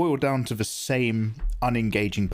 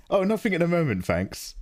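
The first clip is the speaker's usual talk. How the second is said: mock polite brush-off; 7 semitones higher than usual. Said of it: "I'm just like".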